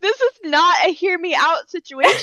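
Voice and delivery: loud, high mock outrage breaking into laughter; mock scolding. Co-host scolding Tabitha for her crush note, mock outraged. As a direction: scold a friend loudly through laughs.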